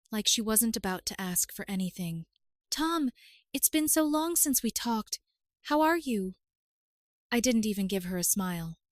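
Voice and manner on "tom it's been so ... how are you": sugary, higher pitched greeting; sickly sweet. Acted. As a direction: lay the sweetness on thick, voice up high. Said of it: "her voice all sweet".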